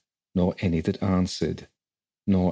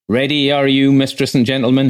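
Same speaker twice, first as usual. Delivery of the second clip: a loud, hearty boatman, pitched higher; gruff boatman; 7 semitones higher, 9 dB louder than usual. A narrator's reading. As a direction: call out to the travellers like a burly quay boatman. Voices the rough boatman.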